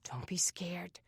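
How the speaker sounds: soft, reassuring whisper; gentle whisper. A narrator's reading. Aaron comforting his frightened little sister, gently whispering. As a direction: soothe a scared child in a whisper. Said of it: "His voice was a gentle whisper".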